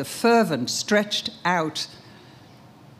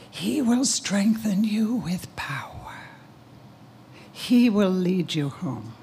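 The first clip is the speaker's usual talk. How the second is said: poem recital; 2 semitones higher than usual. Real, not acted. Mother's poem recited to her son.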